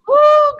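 a high, loud exhale of feeling; emotional whew. Real, not acted. Moved to tears by a friend's testimony, a high, loud whew. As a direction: blow it out, overwhelmed with emotion. Overwhelmed after an emotional story.

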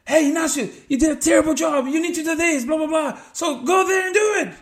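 high, loud scolding tirade; berating coach. Acted. Imitating someone berating a soccer player, high shouted scolding. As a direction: shout orders like a furious coach.

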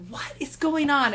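can't believe the news; shocked. Retold or imitated. Hearing Hingis's gossip, high and slow disbelief.

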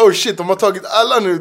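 loud, high, half-laughing; mock alarm at everyone joining; excited realization.

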